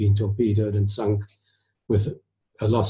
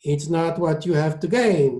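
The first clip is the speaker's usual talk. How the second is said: put-on understated Italian-accented comment; dry comment; 7 semitones higher than usual. A narrator's reading. Maestro counters the surgeon, dry Italian-accented comment. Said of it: "Toscanini commented dryly".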